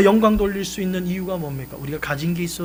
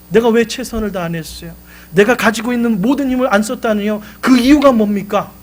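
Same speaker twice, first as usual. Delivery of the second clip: higher and louder, indignant; protesting son; 5 semitones higher, 6 dB louder than usual. Retold or imitated. Son protesting his father's rebuke, loud and indignant. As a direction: push back hard at the father.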